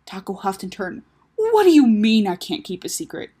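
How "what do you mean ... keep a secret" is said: loud, affronted outburst; indignant. Acted. Taco doubted by his sister, loudly indignant. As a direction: hit "What" hard, then argue back. Offended reply in sibling quarrel.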